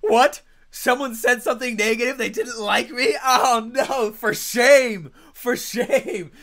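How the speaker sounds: high, loud, hammed-up dismay; over-the-top mock horror at criticism; mock offended outrage. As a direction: play the wounded diva, loud and high. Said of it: "and I'd be like".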